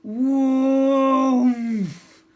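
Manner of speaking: whoomph shouted very high and very loud, stretched out; a big, drawn-out explosion yell of whoomph; booming whoomph. Shown as a demonstration. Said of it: "And it goes".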